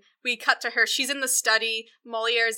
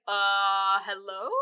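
drawn-out, questioning mock hello; hesitant greeting; much slower than usual. Moliere greeting the wife at his room, hesitant questioning hello. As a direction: greet an unexpected visitor uncertainly. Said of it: "he's just like".

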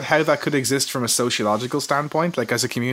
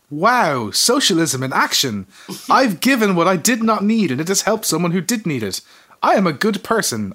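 higher, louder, slow self-satisfied gloating; hammed-up pleased with himself; smug inner voice; 6 semitones higher, 4 dB louder than usual. Man giving change to homeless person, smugly proud. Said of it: "I think to myself".

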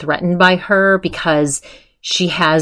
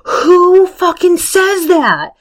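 high, slow, drawn-out mock outrage; offended outrage; 13 semitones higher, 4 dB louder, slower than usual. Imitating Adrian so offended by that remark, offended outrage. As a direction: imitate her indignant outburst, pitched way up. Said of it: "she was so offended by that".

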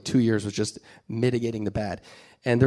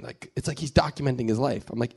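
usual speaking voice

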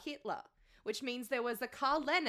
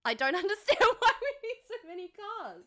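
laughing; 9 semitones higher, 6 dB louder than usual. Real, not acted. Laughing rant about cars.

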